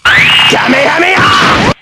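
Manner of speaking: huge, high, slow Kamehameha yell; battle cry shout. Acted. Heroes unleashing their attack on the villain, yelled. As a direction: scream the attack with everything. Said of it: "we said upon to Mister Psy guy".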